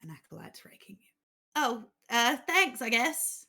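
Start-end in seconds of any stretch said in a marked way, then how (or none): 1.6-3.4 sarcastic acolyte. Acted. GM voices unimpressed acolyte.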